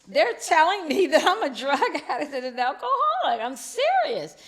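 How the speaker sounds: loud, high, half-laughing half-crying plea. Retold or imitated. Mother insisting to her smiling daughter, laughing through tears. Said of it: "and I'm crying".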